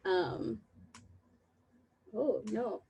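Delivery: ordinary talk, nothing marked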